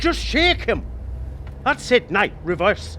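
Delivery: high, loud thrilled commands; excited urging. Acted. Passenger eggs on the cabbie in a chase, loud and thrilled. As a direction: egg the driver on like a getaway.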